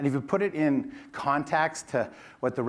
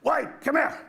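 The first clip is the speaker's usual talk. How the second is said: sharp high yell of a surname across the store; boss yelling name; 13 semitones higher than usual. Retold or imitated. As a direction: bark the name down the aisle like an angry boss. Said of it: "That's exactly how he sounded".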